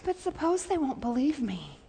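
soft, insecure murmur; hesitant and small, nothing formal; unsure, informal Moses. Acted. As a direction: say it timidly, like a real person doubting. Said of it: "not in a, in a formal biblical voice".

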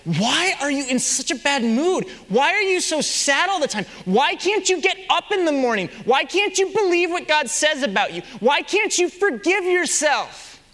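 nagging yourself in frustration; scolding your own soul. Retold or imitated.

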